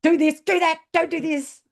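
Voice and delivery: loud, high, mock barked commands; acted drill-style shouting; bad cop orders. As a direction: rattle off orders as the bossy coach. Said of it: "I was bad cop".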